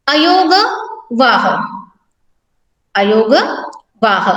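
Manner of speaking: अयोग and वाह said apart, with pauses between; split syllables. Shown as a demonstration. Teacher splits the word for students, slow and deliberate. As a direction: break अयोग वाह into parts for the class. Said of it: "आगे देखें".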